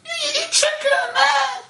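very high, squeaky comic cry; hammed-up falsetto squawk; mock squeal. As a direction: squeal it in absurd mock outrage. Mock self-scolding over craving chicken.

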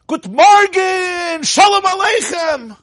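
huge high, long-drawn shout; hammed-up screaming hello; screamed greeting. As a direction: bellow a greeting, stretching every vowel. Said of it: "he screamed".

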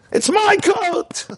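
slow, high, drawn-out mock yell; the rabbi's hammed-up inner scream. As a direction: playfully exaggerate your own stubborn cry. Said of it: "What do you think I was screaming?".

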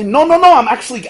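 high, loud insistence; loud, hurried-sounding objection, played up; mock protest. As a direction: push back urgently, then ease off. Acted husband protesting to wife.

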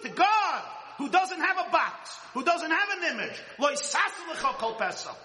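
high, loud, impassioned sermon cry; rising preacher's fervour; fervent preaching. Acted. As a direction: pour it out from the pulpit. Preaching at full force.

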